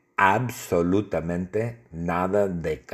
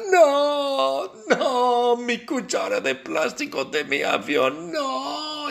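much higher, wailing mock Trump; Trump crying out. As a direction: squeal like a panicked Trump begging. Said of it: "Y Donald grita". (Trump grita agudo y lloriquea por su cuchara)

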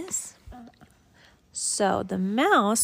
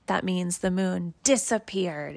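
ordinary delivery, nothing marked

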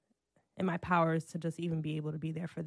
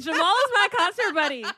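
laughing it out, higher and louder; 10 semitones higher, 9 dB louder than usual. Real, not acted. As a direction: say it while cracking up at yourself. Repeats line while cracking up.

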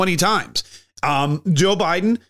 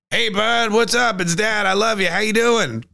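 higher, cheery dad voice on the phone; imitating Joe Biden; 6 semitones higher than usual. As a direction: greet your son brightly, pitched higher. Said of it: "And then Joe goes".

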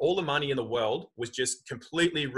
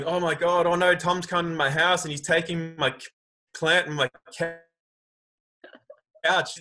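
higher, louder hammed-up fretting; mock-frightened wail about losing the couch; mock panic; 4 semitones higher, 4 dB louder than usual. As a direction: play a person panicking over nothing, half in jest. Mock panic over Tom's visit.

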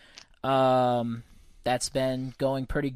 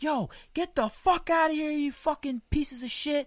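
high-pitched imitation of an irate stranger; disrespectful angry dude; 12 semitones higher than usual. Irate land guard cursing at photographers, high mock yell. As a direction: yell it high, mocking his aggression. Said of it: "being really disrespectful".

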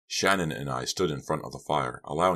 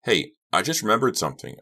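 higher, louder, suddenly eager; excited; 6 semitones higher, 5 dB louder than usual. A narrator's reading. Breaking into the argument with a thought, excited. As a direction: burst in with a new idea. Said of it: "excited by my thought".

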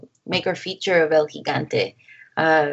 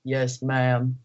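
imitating a calm, polite man, low voice; low, soft, unruffled obedient reply; 7 semitones lower, 4 dB quieter than usual. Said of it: "he's so calm".

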